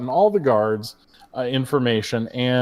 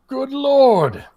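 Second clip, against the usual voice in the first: posh orc exclamation; 9 semitones higher than usual. Acted. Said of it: "He's like".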